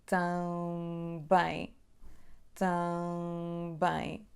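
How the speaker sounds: tão bem very slow, lips closing only for the B; slowly, one bilabial: tão bem; slow model word. Shown as a demonstration. Teacher modelling também slowly, one bilabial sound. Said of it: "Há só um som bilabial aqui".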